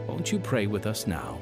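usual speaking voice

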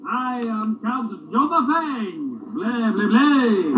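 soft, slow, would-be Count; faked vampire menace, understated. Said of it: "Talk like a vampire".

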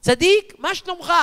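high mock yell into a deaf man's ear; imitated yelling greeting, loudest on the first word; retold scream. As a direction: shout it up high for someone hard of hearing. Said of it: "צרחתי".

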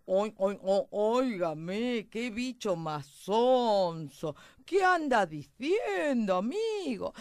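loud, very slow, drawn-out pig voice; oinking pig. Acted. Pig asks the mouse what he is saying, dismissive drawl. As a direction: stretch the vowels like a lazy grunting pig.

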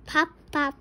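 snappy popping sounds; cork pop. Shown as a demonstration. Parent reading a noise book to a child, popping pops out loud. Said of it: "He can sound like a cork".

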